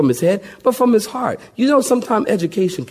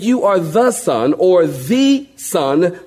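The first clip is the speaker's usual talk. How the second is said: "the Son" with THE stretched and stressed; slower than usual. Shown as a demonstration. Preacher stresses the word the in Peter's answer. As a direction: lean hard on the, slow. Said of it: "He didn't say".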